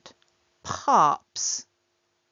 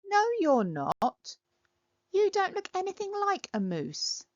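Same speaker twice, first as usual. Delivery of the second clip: high scoffing reply; 11 semitones higher than usual. Acted. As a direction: scoff at the claim. Other character scoffs.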